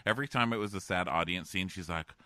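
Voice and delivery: normal talking voice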